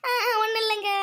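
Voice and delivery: high, tremulous little denial; hammed-up timid squeak; meek whimper. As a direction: whimper innocently on being caught. Caught muttering, wife denies it meekly.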